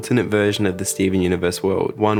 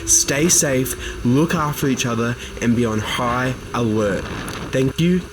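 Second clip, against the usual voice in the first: put-on raised-pitch Australian accent as a live TV news reporter; 4 semitones higher than usual. [name] auditioning as a TV reporter, Australian-accent news read. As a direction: deliver the news bulletin in a put-on Australian accent, higher than your own voice. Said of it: "playing a character with an Australian accent".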